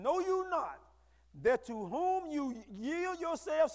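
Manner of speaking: slow, drawn-out "Know you not that to whom you yield yourselves"; slow scripture reading. Shown as a demonstration. Preacher rereading the verse slowly for the congregation. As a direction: stretch every word of the verse. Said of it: "read that again a little slower".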